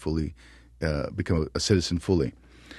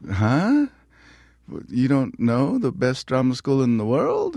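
high-pitched disbelief, mildly hammed-up; incredulous retort; 7 semitones higher, slower than usual. Young actor to unimpressed casting people, incredulous. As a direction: act taken aback, pitch jumping up.

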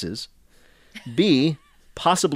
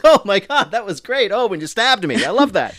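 gushing actor; 7 semitones higher, 4 dB louder than usual. Retold or imitated. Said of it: "you're like".

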